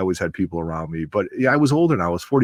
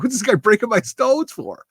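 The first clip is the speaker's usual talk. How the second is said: high, pitched-up mock complaint; mock exasperation; 13 semitones higher than usual. Griping about the mentor's mic notes mid-show, high mock exasperation. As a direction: play it as a comic high-pitched gripe. Retelling annoyance at mentor's nagging.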